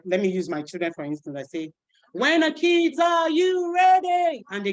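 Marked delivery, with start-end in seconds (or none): 2.1-4.4 high, loud, drawn-out call; teacher call. Shown as a demonstration. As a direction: call out to the class. Call-and-response with kids.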